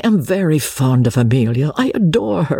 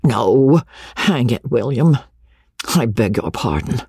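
ordinary delivery

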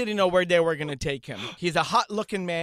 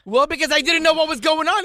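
excited; 10 semitones higher, 8 dB louder than usual. Retold or imitated. Excited retelling.

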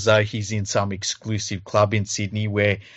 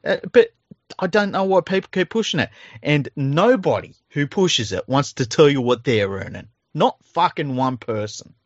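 exasperated rant, pitched higher; indignant; 5 semitones higher than usual. Real, not acted. Podcast host ranting about people prying into players' pay, indignant. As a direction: protest it in a high, incredulous voice.

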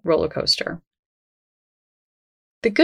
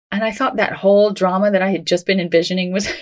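ordinary delivery

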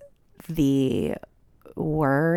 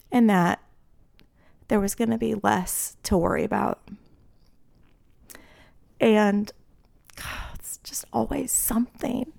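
teary, half-whispered, voice catching; holding back real crying, breaking into whispers; real tearful; 4 semitones higher than usual. Real, not acted. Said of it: "I'm gonna start crying".

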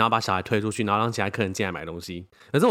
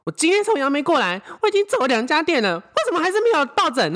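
much higher, louder complaining mother; imitating an irate customer mom, high and loud; shrill angry mother; 4 dB louder than usual. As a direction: play the furious mom, pitched way up.

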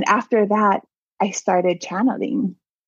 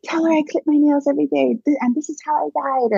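high, hurried imitation of urging; eager insistence; 7 semitones higher, faster than usual. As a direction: rattle it off like an impatient messenger, pitched up. Husband pours out proofs.

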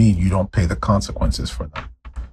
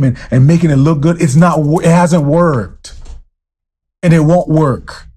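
raised, fervent preaching voice; 6 semitones higher, 3 dB louder than usual. Acted. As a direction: preach it out with rising passion. Preaching at full force.

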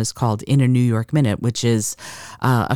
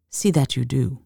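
put-on lightly French-accented dismissal; a hint of French, poised; subtle French accent. Woman sending the staggering accountant away, subtle French accent. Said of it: "softened by a subtle French accent".

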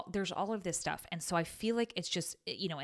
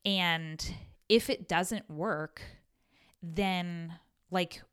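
normal talking voice

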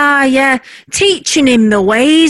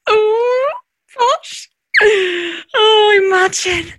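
dreamy; 6 semitones higher, much slower than usual. Acted. Wife savours being posh.

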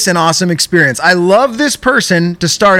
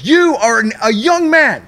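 much higher, lecturing like a stern father; scolding dad; 9 semitones higher than usual. Retold or imitated. Agent lecturing the caller like a father. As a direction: talk down to him like a dad. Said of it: "Like, he's my dad".

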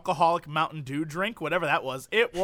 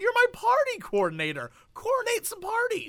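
whiny pleading voice. Retold or imitated. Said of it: "I was like".

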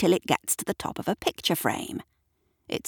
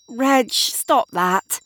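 slow, higher-pitched reprimand; scolding; 7 semitones higher, much slower than usual. A narrator's reading. Shirley scolds Reg for barking, exasperated. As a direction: firmly tell off the dog.

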